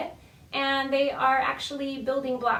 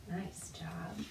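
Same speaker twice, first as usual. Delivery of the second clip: soft whispered nice job; whispered praise; 7 semitones lower, 14 dB quieter than usual. Real, not acted. Librarian whispering praise to the children. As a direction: whisper it like a secret.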